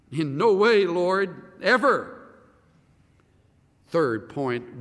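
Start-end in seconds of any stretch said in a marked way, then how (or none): 0.1-2.2 loud, higher, insistent refusal; emphatic refusal. Retold or imitated. Peter refusing to let the Lord wash him, loud. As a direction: hammer out the no. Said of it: "That's the thought".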